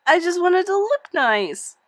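high, loud, crying wish; tearful wail. Acted. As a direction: wail it through tears. Crying girl repeats her wish.

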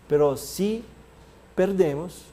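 ordinary talk, nothing marked

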